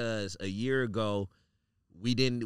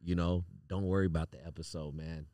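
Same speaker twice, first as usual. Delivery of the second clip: soft, lower consoling voice; calm him down gently; reassuring; 6 semitones lower, 6 dB quieter than usual. Retold or imitated. Consoling a distressed friend, retold.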